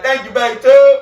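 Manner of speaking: high, loud announcement; shop call-out. Retold or imitated. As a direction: call it across the bays. Calling out over shop intercom.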